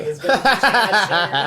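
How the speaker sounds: slow loud laughing words. Real, not acted. Laughing about bad audio.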